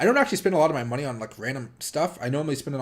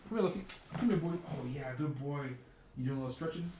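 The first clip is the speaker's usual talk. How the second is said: puppy talk; 2 semitones higher, 8 dB quieter than usual. Real, not acted. Coaxing the sleepy puppy to come, soft baby talk. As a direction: sweet-talk the puppy into coming over.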